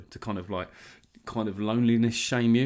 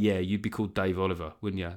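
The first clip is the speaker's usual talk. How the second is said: ordinary delivery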